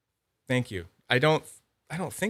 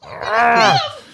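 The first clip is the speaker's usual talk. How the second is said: high squeal. Real, not acted. Reacting to the pet.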